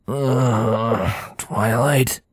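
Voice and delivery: groggy. A narrator's reading. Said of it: "he asked groggily".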